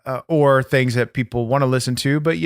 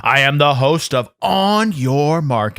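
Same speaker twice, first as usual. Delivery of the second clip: usual speaking voice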